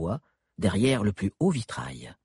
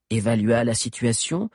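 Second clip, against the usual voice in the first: usual speaking voice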